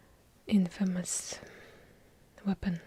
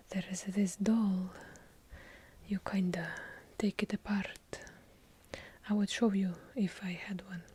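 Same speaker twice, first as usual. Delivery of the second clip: ordinary talk, nothing marked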